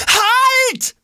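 very high alarmed yell; screamed command. A narrator's reading. Jonas as the bag is torn away, screaming. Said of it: "schreit Jonas".

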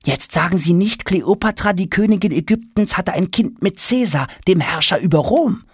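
put-on high, incredulous boyish voice; can't believe the gossip; amazed boy Tom. Tom stunned by news about the queen's child.

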